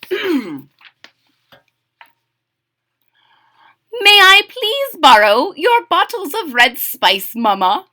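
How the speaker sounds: high, loud, sweetly pleading kitten; polite request. A narrator's reading. A kitten politely asks his mama to borrow spice, polite high voice. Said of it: "he asked politely".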